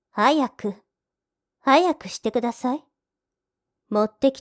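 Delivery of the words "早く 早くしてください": louder, pushing him to hurry; raised impatient urging; impatient order. Acted. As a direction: snap the command to rush him. Maid orders master to hurry.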